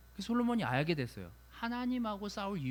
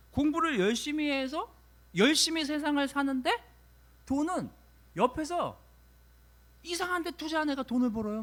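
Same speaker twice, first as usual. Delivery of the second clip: higher and louder, pressing each phrase; fervent preaching; 7 semitones higher, 5 dB louder than usual. Acted. Preacher railing to the congregation about life's unfairness, fervent. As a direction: preach the unfairness with rising heat.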